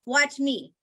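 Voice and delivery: normal talking voice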